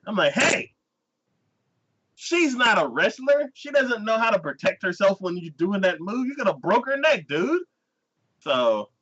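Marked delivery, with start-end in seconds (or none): 0.3-7.6 high, indignant telling-off; exasperated high-pitched rebuke; mock scolding. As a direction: protest on her behalf. Speaker scolds the character.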